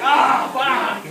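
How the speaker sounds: loud, high comic curse; hammed-up yell of defeat; mock dismayed outburst. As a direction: cry out as if just convicted. Acted dismay at guilty verdict.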